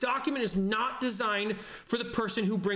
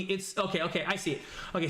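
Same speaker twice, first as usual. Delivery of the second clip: ordinary talk, nothing marked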